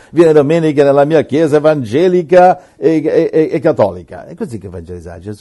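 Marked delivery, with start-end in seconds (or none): 0.1-4.2 louder, hammed-up sales pitch for church; pushy evangelist. Parodies an evangelist pushing his church, louder. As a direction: oversell the invitation, then stumble.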